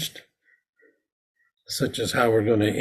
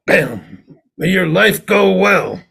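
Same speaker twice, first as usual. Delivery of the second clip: louder, higher, slow blessing after a strike; emphatic blessing; 7 semitones higher, 5 dB louder, slower than usual. Shown as a demonstration. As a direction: strike the post and bless them slowly. Shippei strike then blessing.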